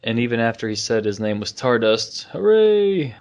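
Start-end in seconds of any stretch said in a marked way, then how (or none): 2.2-3.1 mock cheer.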